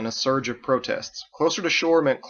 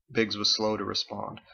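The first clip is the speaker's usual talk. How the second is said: usual speaking voice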